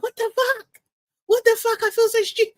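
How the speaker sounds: high, shrill mock outburst of rage; imitating angry outburst. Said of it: "She was like".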